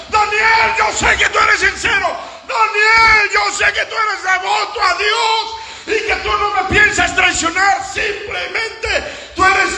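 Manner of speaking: high, shouted preaching as the king; fervent, high-pitched shouted avowal; impassioned pleading. Acted. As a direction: cry out each assurance to Daniel at full force. Preacher voices king pleading with Daniel.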